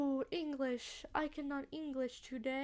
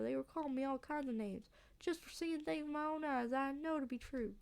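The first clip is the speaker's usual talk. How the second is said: aggrieved Southern country voice; offended local, Southern accent. Acted. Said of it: "a southern accent".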